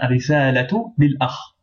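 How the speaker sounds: ordinary talk, nothing marked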